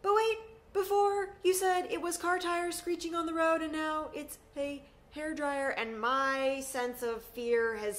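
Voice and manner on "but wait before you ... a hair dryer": high, loud, jittery objection; mock panicked teen. A scared sixteen-year-old catching mom's changing excuses, in a high, anxious voice. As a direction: push back on mom's excuse with rising alarm. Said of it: "now I'm like".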